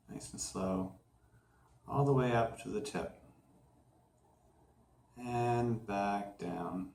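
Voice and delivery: unhurried, softened voice with pauses between phrases; guided meditation. Acted. Host guiding listeners through a clearing visualization, calm meditation voice.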